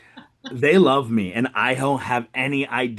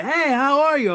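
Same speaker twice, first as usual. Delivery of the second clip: over-bright greeting; 4 dB louder than usual. Retold or imitated. Greeting a nervous customer brightly.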